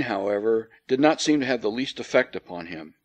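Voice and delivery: ordinary delivery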